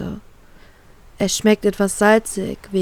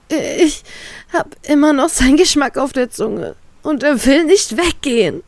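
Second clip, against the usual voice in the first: higher-pitched, strained despair; desperate; 6 semitones higher than usual. Acted. Heroine telling Trafalgar of the assault, desperate. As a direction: plead it out, near tears. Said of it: "beende ich verzweifelt".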